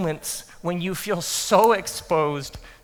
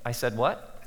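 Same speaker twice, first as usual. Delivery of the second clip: soft, lower, half-laughing disbelief; quiet disbelief; 7 semitones lower, 9 dB quieter than usual. Retold or imitated. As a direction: murmur it with a rueful laugh. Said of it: "you find yourself thinking".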